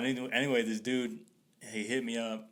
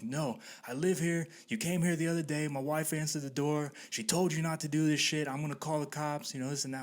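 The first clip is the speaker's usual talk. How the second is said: high, insistent retold telling-off; imitated scolding homeowner; 6 semitones higher than usual. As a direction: lay down the law as an irked homeowner. Acting homeowner scolding the kid.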